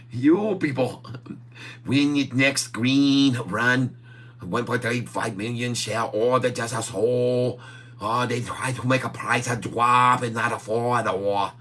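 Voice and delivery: hammed-up mock Chinese accent; held mock Chinese accent.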